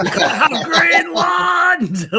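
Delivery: high, slow battle-cry shout through a laugh; William Wallace in Braveheart. Retold or imitated. Retelling the Danish quote as a Braveheart battle cry. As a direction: bellow it like a Highland rebal charge. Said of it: "Jason made him sound like, uh, William Wallace in Braveheart".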